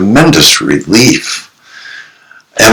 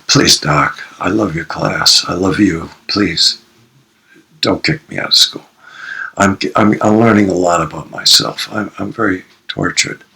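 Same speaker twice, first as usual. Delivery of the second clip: pleading; 7 dB quieter than usual. Retold or imitated. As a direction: plead quietly with the teacher. Student begs not to be expelled.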